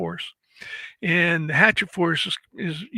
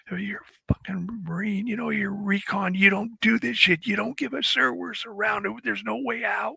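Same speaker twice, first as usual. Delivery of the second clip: retold yelling; 5 semitones higher than usual. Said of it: "starts yelling at him".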